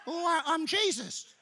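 high, meek little put-on voice; Jesus. The Lord introducing himself in heaven, comically high-voiced. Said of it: "have a deeper voice than that".

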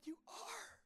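put-on high, soft, slow whispered old woman; whispered old woman blessing the young man. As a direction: breathe it gently like a very old lady. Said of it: "She said".

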